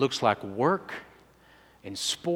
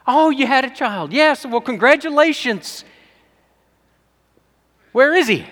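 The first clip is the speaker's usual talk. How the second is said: much higher, louder gushing lady voice; gushing friend; 12 semitones higher, 11 dB louder than usual. Retold or imitated. Mutual friend gushing over the new baby, high and loud. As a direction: squeal it like a delighted society friend.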